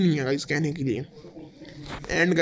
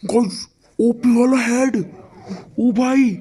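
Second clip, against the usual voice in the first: higher, louder burst of amazement; excited exclamation; 6 semitones higher, 5 dB louder, slower than usual. Real, not acted. Gamer to viewers after a headshot, excited exclamation. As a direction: cry out in thrilled disbelief, drawn out.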